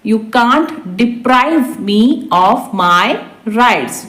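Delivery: word by word for learners; slow repeat. Shown as a demonstration. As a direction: stretch it out, pause before the end. Teacher repeats translation word by word. (धीरे-धीरे, शब्द-दर-शब्द)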